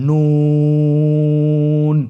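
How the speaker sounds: নুন with the vowel held very long and thin; slow held recitation of নুন; long drawn vowel. Shown as a demonstration. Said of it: "আবার বলুন".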